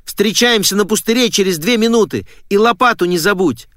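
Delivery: high, brisk-spirited instructions; excited. A narrator's reading. Girl summoning her friend to dig, excited.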